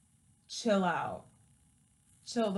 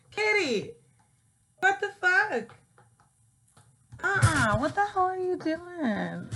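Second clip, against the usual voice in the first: higher, louder exasperated scolding; scolding the cat; 7 semitones higher, 7 dB louder than usual. Real, not acted. Owner scolding her wild cat, exasperated and loud. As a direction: tell the cat off, half laughing.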